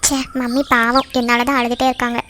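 guilty pity. Acted. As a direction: murmur it low-key, feeling sorry for mum. Guilty over mother crying.

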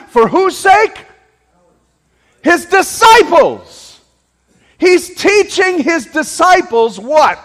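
high, loud, insistent sermon delivery; impassioned pulpit shouting, driving question after question; full-force preaching. Real, not acted. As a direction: hammer each question at the congregation.

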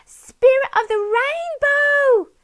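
very high, loud, slow call; joyful shout. A narrator's reading. Polly greets the boy on Jake with joy. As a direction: cry out his name in pure joy. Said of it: "she laughed in pure joy".

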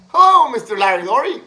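high, loud, fast sing-song hello; a teasing kid greeting the teacher; mocking greeting. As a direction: bust his balls with a cheery hello. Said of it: "just to bust his fucking balls".

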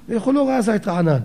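half-shouted, imitating the rabbi's protest; retold shout. Rabbi complains that his student missed the source, mock shouting. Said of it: "הרב ככה הקים קול צעקה".

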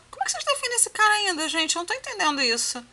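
outraged. Real, not acted. Outraged at defenders of the husband.

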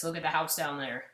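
normal talking voice

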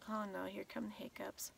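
quick soft whisper; breathy, hurried whisper. Real, not acted. Hiccups starting late at night.

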